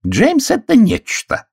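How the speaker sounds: high, slow indignant declaration. A narrator's reading. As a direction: scold him grandly. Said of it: "с негодованием".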